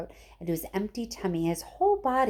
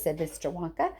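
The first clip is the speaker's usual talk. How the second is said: ordinary delivery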